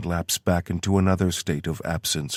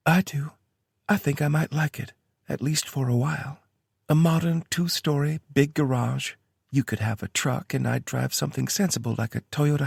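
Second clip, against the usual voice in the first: lightly higher woman's voice; Tara voice; 9 semitones higher than usual. A narrator's reading. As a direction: read her lines a bit higher. Tara's voice in story.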